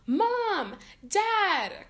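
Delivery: high, loud calls to her parents; crying out. A narrator's reading. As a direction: shout for Mom and Dad in panic. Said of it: "cried Candace".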